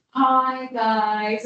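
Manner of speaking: mock influencer greeting, drawn out. Panelist mimicking influencers who elongate everything, influencer voice. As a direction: stretch the words like a vlogger's intro. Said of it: "there's this thing called influencer voice".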